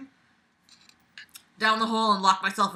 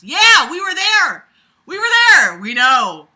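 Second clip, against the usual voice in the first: exasperated shout; 8 semitones higher, 14 dB louder than usual. Real, not acted. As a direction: yell impatiently at the letter writer. Impatient heckling of the letter.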